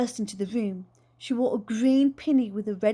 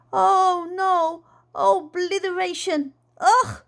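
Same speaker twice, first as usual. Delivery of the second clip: loud, high revulsion; disgusted; 7 semitones higher, 5 dB louder, slower than usual. Acted. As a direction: squeal in disgust. Aunt disgusted by worm eating.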